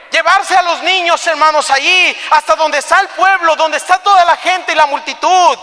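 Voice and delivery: high, loud, pressing storytelling; impassioned preaching. Acted. Preacher picturing children dragged before the crowd, impassioned. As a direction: preach the scene with mounting force.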